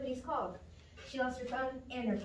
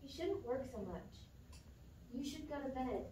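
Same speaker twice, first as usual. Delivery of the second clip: ordinary talk, nothing marked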